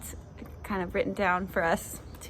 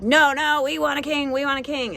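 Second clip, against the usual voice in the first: higher-pitched mock clamouring; mock insistent demand; 5 semitones higher than usual. Israelites demanding a king from God, hammed-up insistence. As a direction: plead like an insistent crowd, playfully.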